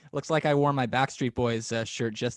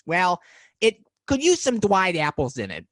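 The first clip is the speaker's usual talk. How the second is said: high, louder cub voice with w for r; Baby Bear; 6 semitones higher, 5 dB louder than usual. Retold or imitated. Baby Bear answering Alan about porridge, high childlike voice. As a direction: pipe up like a little bear asking for a treat. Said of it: "And Baby Bear says".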